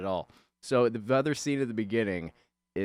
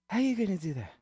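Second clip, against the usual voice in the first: pitched-up mock question; 11 semitones higher than usual. Voicing the other character.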